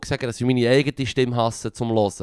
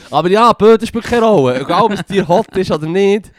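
high, loud comic protest; worked-up, raised-pitch objection; indignant; 7 semitones higher, 7 dB louder than usual. Real, not acted. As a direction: protest loudly through amusement. Protests about the animal dying out.

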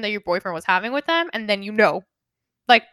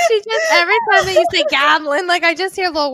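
higher-pitched, tearful laughing speech; helpless laughter; 6 semitones higher, 4 dB louder than usual. Real, not acted. Friend teasing cohost's way of saying goblin, laughing. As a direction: squeeze the words out through real laughter. Cracking up over cohost's pronunciation.

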